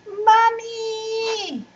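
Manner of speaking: put-on high child's yell for mommy; little girl shrieking Mommy. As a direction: cry out for mom, loud and high. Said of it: "yelled Shala".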